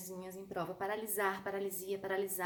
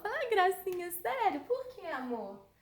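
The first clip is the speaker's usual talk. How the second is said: mock sweetness; 8 semitones higher, 3 dB louder than usual. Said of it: "teacher's mock sweet reply".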